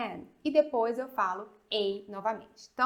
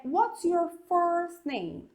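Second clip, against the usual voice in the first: higher and slower "What's your full name?"; slow demo question; 7 semitones higher, much slower than usual. Shown as a demonstration. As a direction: ask it clearly, higher and slow. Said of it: "Então olha aqui".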